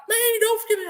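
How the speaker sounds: very high imitated plea; mock pleading. Wounded Marine fearing being left, imitated high plea. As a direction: beg in a squeaky put-on voice.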